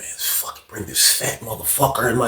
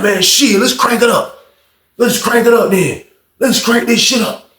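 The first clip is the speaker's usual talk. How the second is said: hyper challenge; 7 semitones higher than usual. Retold or imitated. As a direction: hype yourself up to gamble. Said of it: "He getting hyper with it".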